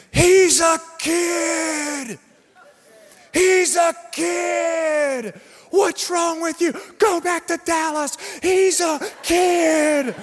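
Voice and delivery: high, slow shouted scolding; yelling fans. Retold or imitated. Saints fans to the Cowboys fan, high shouting. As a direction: bellow it like an angry crowd. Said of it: "they start yelling this guy".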